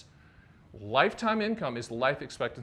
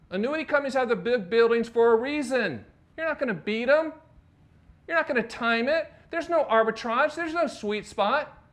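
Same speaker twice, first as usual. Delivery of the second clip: normal talking voice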